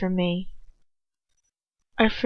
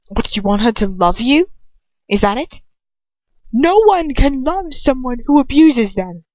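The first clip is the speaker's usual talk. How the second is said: heated retort; 4 semitones higher, 5 dB louder than usual. A narrator's reading. Heated argument with Lucius.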